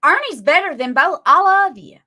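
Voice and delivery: normal talking voice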